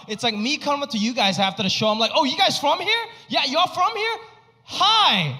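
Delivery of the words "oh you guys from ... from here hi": high, hammed-up greeting; mock friendly greeting. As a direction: play the clueless fan. Mocking greeting audience after show.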